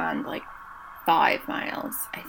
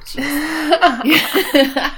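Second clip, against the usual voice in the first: laughing; 9 semitones higher, 8 dB louder than usual. Real, not acted. Laughing at own awkward phrasing.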